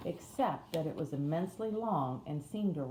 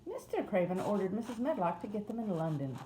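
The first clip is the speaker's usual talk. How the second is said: higher-voiced Yorkshire housemaid; broad Yorkshire accent; 4 semitones higher than usual. A narrator's reading. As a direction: wake them in broad Yorkshire, pitched up. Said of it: "in a broad Yorkshire accent".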